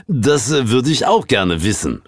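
stern superior butting in; Sir James calling out. A narrator's reading. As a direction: call over as the suspicious boss. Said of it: "rief Sir James".

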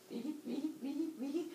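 high, soft "ee" squeals; half-hearted high comic screaming; small mock screams. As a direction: squeak at each little stab. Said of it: "Uranus screaming".

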